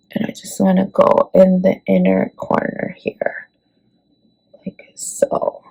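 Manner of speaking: soft unvoiced whisper while working. Real, not acted. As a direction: drop to a breathy whisper as you apply. Makeup demo, sudden whisper.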